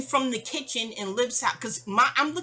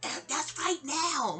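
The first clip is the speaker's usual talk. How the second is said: high, slow mock yell; put-on high voice, stretched words; [name]; 6 semitones higher, slower than usual. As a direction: imitate a landlady yelling, drawn out and pitched up. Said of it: "[name]'s yelling".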